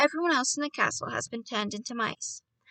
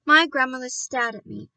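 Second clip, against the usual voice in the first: plain reading voice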